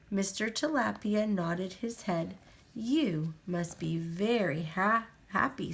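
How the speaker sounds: usual speaking voice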